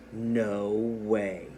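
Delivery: low, unexcited refusal; unexcited, unhappy. Shown as a demonstration. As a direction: say it as an unhappy, stubborn knee. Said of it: "I didn't read it in an excited voice".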